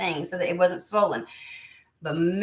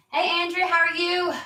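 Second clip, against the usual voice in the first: high excited hello; bright greeting; 12 semitones higher than usual. Real, not acted. Streamer greeting viewer Andrea, high excited hello.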